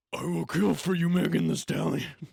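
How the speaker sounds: loud unhinged declaration; psycho voice. Retold or imitated. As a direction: yell it like a crazed fan. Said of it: "Like some psycho".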